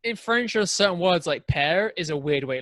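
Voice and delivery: normal talking voice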